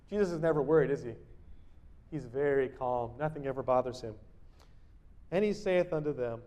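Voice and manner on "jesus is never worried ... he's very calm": normal talking voice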